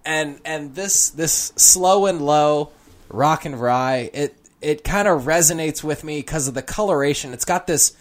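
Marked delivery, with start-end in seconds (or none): 0.0-4.1 savouring. Acted. Savouring description.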